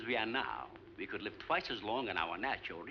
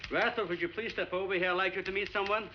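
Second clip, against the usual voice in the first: higher-pitched, raised voice; calling over; 7 semitones higher, 4 dB louder than usual. Acted. Doctor summons assistant to meet the captain, calling over. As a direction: call across to someone absorbed in work.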